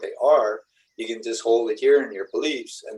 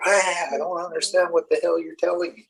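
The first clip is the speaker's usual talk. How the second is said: exasperated outburst; 7 semitones higher than usual. Retold or imitated. Cindy freaking out at him.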